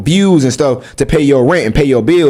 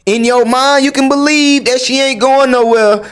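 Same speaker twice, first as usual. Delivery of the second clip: mocking the deluded man; 9 semitones higher than usual. Real, not acted. Preaching point, raised voice.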